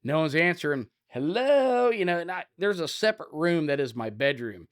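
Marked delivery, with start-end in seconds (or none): 1.1-1.9 high, drawn-out call; loud calling out. Retold or imitated. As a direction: call again to whoever might be hiding. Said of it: "I'm a loud guy".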